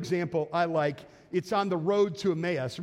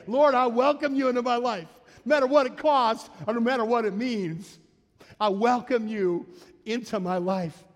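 louder, earnest prayer voice; fervent prayer; 3 semitones higher, 3 dB louder than usual. Shown as a demonstration. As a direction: pray it aloud with fervour. Models a prayer aloud.

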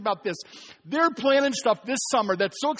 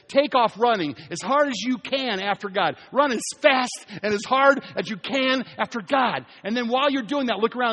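preaching push; 4 semitones higher, 6 dB louder than usual. Acted. Preaching at full force.

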